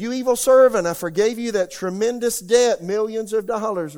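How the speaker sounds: loud, high furious rebuke; a king scolding an ungrateful servant; angry king. Acted. King confronting unforgiving servant, loud angry rebuke. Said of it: "the angry king".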